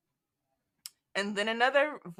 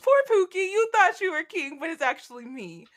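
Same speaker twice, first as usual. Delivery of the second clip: much higher and louder, singsong taunt; mock gloating; 8 semitones higher, 5 dB louder than usual. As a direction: gloat over the fallen king.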